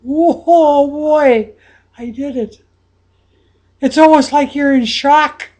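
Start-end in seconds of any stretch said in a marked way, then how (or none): 0.0-2.6 1 stunned joy. Acted. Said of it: "But you're going".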